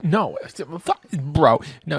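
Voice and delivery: loud, exasperated refusal; a loud no way, bro; indignant outburst. Real, not acted. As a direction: push back hard, fed up. Refusing the game's request.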